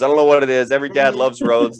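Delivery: usual speaking voice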